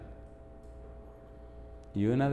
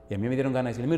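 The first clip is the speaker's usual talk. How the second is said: normal talking voice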